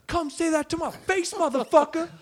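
very high, loud mock challenge; confrontational yell. Upset ladies to other table, confrontational. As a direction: imitate furious women squaring up. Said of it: "They stand up from their tables".

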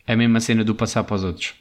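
normal talking voice

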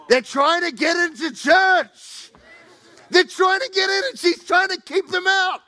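loud, high outrage at the door lady; indignant. Real, not acted. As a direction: push it out, high and loud, appalled. Indignant retelling at church.

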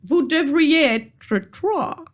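put-on high, slow British-accented troll. Troll checks his paper at the bridge, high, slow British accent. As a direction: spell it out slowly in mock British-accented French. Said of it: "charmant accent britannique".

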